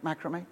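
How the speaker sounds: "macrame" said MACK-ruh-may; "macrame" as a soft question, stressed on MACK; short a in the first syllable of "macrame". Shown as a demonstration. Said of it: "I don't know if you call it".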